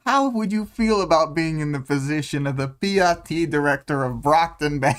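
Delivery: lightly voiced high sly mobster; a hint of a smooth-talking mob boss; movie mobster voice. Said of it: "movie mobster voice".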